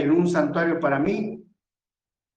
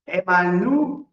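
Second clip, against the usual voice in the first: Emmanuel sounded out slowly, held on a rising NU; slow dictation; 2 semitones higher, 3 dB louder, slower than usual. Shown as a demonstration. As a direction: spell out Emmanuel by ear, syllable by syllable. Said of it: "con doble M".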